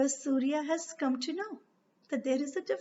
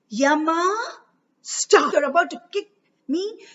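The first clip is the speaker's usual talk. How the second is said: angry curse; 2 semitones higher, 7 dB louder, slower than usual. A narrator's reading. Angry stepmother cursing Yama for raising his foot. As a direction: hurl a curse at the boy. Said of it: "she gets so angry".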